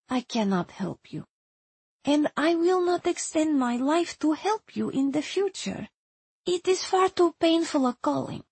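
put-on soft, mostly high accented voice; reluctant Hungarian woman. Erzsébet refuses to help the visitor, soft reluctant accented voice.

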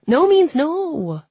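high, loud, slow and drawn out; emphatic refusal. A narrator's reading. Sister shuts down her prying brother, emphatic refusal. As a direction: slowly hammer home the refusal.

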